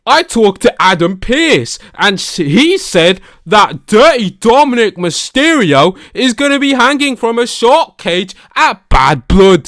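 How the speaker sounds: hammed-up Australian accent; bad Australian accent. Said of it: "such a bad impression of ... an Australian accent".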